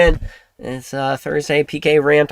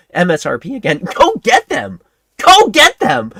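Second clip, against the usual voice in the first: loud, higher, pushing each command; heated rant; 5 semitones higher, 6 dB louder than usual. Real, not acted. Streamer yelling at an imagined skeptic, heated and loud. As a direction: shout the orders at him, exasperated.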